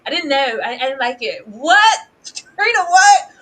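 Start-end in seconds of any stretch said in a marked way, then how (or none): 1.5-3.3 higher and louder stunned "what"; shocked outcry. Real, not acted. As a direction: blurt it out in disbelief. Shocked outburst.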